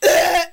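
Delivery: loud hammed-up coughing fit; mock dying cough. Host fakes allergy death for subscriptions, loud hammed-up cough. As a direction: fake a choking cough for laughs.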